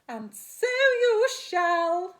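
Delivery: high, very loud, drawn-out fairy voice; hairy godmother. A narrator's reading. Godmother grants Spinderella's wish, high loud proclamation. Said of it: "came a voice".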